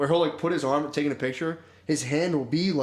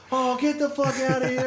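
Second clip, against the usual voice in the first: high, mock yell of a crowd; 11 semitones higher than usual. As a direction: yell him out of the room, half-mocking. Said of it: "everyone's like".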